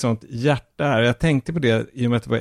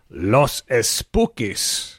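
slow, drawn-out Spanish accent; Latin Spanish flourish; much slower than usual. Shown as a demonstration. Host announcing his series tip, with a Spanish flourish. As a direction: savour the title in a showy Spanish accent. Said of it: "Man vill säga det på det där latinska, spanska sättet".

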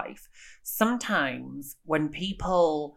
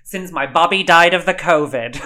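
hammed-up, blurted announcement; loud imitation of a dramatic reveal; loud mock reveal; 10 dB louder than usual. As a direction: quote it at big, loud volume. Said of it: "where she reveals".

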